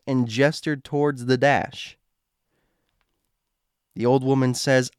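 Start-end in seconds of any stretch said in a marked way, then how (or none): none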